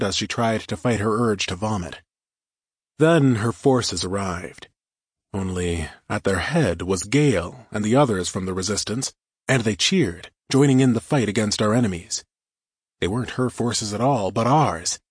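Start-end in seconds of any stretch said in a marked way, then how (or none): none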